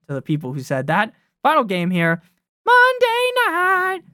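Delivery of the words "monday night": much higher, louder, slowed showman voice; drawn-out ring-announcer intro; mock announcer call. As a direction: stretch it like a boxing announcer. Announcing final game.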